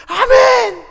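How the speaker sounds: loud, very high response; eager amen. Acted. Believer answering God's promise of help, loud and eager. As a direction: call it straight back, high and loud.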